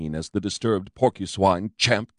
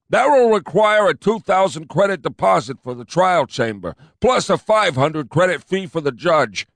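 higher, louder pompous cop; fat cop insisting; 8 semitones higher, 5 dB louder than usual. A narrator's reading. Fat cop insisting to his partner, pompous.

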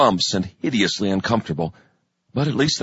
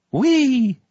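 mock cheer; 12 semitones higher than usual. A narrator's reading. Mock cheer at recruit's story.

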